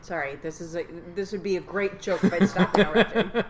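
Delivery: loud, laughing through the words; laughing apology. Real, not acted. Co-host admits she keeps interrupting his joke, laughing apology. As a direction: break into real laughter while talking.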